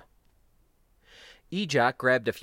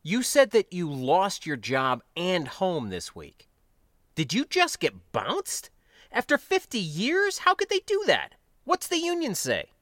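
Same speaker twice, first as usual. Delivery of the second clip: incredulous; 9 semitones higher, 3 dB louder than usual. Acted. Younger spacer hearing of the firing, incredulous. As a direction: voice climbing high in disbelief. Shocked friend questions the lost job.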